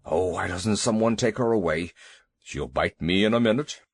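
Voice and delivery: a huge elephant begging a small dog to leave; low fretful elephant voice; timid elephant. Acted. Elephant Two Tails pleading.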